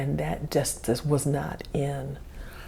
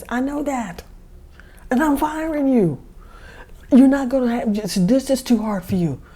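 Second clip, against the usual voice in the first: higher and louder, anger squeezed into a squeak; angry high-pitched voice; 7 semitones higher, 5 dB louder than usual. Retold or imitated. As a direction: fire her in a tight high voice, holding back fury. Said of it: "my voice gets high pitched 'cause I'm trying to control my anger".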